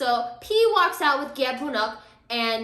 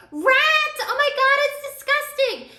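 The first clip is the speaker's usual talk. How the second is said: high, loud fake scream; 13 semitones higher, 7 dB louder than usual. Gye Bonok faking a rat scare to distract, loud high shriek. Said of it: "Gye Bonok screams".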